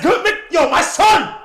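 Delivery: high, loud, slow yell; excited shout. Real, not acted. Cheering his favourite player's bucket, excited shout. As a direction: cheer like a proud fan.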